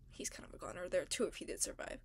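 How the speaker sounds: unvoiced hushed aside; whisper. Real, not acted. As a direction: whisper it like a secret.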